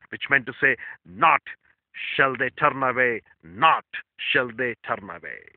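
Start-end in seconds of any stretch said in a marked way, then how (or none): 1.1-5.5 slow, repeated "not shall they turn away"; double emphasis. Shown as a demonstration. Preacher stressing the doubled Hebrew command, slow and firm. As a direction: lean on "not" both times, slowly. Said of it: "Two times emphasis of the word".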